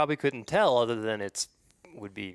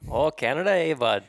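hammed-up Canadian accent, louder; 4 semitones higher, 7 dB louder than usual. Cohost kicks off the accent bit, loud mock Canadian accent. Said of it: "Should I do the whole podcast in this voice?".

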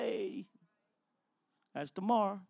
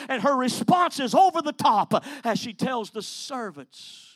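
loud, rising preacher's cadence; full-force preaching; 3 semitones higher, 5 dB louder than usual. Acted. Preacher proclaiming Jesus' miracles to the church, loud. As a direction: build it like a pulpit climax.

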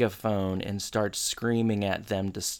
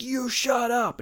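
mock scream; 13 semitones higher than usual. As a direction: imitate yelling back through a wall. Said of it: "some guy is screaming through the wall".

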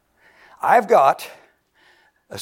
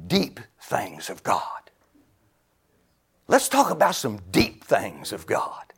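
whisper; 6 semitones lower, 4 dB quieter than usual. Acted. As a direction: lean in and whisper the mystery. Hushed preaching.

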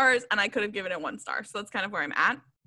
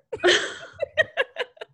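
high airy burst of laughter; breathy high giggle; 14 semitones higher than usual. Real, not acted. Co-host cracking up at a quoted line, airy high laugh. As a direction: let the laugh escape, unvoiced.

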